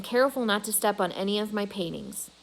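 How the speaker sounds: ordinary delivery, nothing marked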